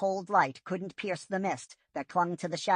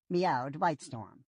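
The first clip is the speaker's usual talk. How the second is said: plain reading voice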